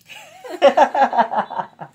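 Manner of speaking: a high, loud, real laugh; loud burst of laughter. Real, not acted. Laughing at his own retelling of the greeting. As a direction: break out laughing hard.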